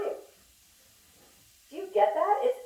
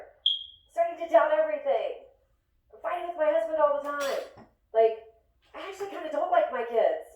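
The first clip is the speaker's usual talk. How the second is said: higher, gushing overshare to a best friend; pouring your heart out; 7 semitones higher than usual. Shown as a demonstration. Friend confiding over wine, gushing and overwhelmed. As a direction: spill it all out in a rush, half laughing. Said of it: "It's pouring your heart out talk".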